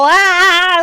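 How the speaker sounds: high, loud drawn-out "ah"; little goblin yell. Shown as a demonstration. Streamer imitating chat's goblins joining a raid, high mock yell. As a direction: cry out like a little charging goblin. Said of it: "come in with the little".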